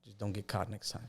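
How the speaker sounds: quiet, sly aside; a soft, cheeky punchline; wry inner thought. Retold or imitated. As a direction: son jokes to himself about not getting caught, quietly.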